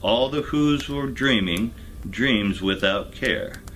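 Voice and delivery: usual speaking voice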